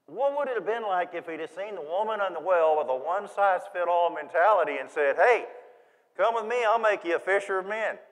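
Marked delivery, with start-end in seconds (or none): 5.1-8.0 mock pitch. Jesus voiced, one-size-fits-all pitch.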